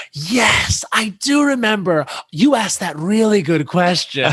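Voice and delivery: bright excited remembering; Molly Shannon thrilled to recall the question; imitating Molly Shannon.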